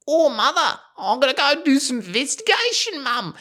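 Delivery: put-on high, eager boyish voice; young Norbert voice. Norbert telling his mum, in a high boyish voice. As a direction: chirp it to mum like a keen lad. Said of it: "He goes".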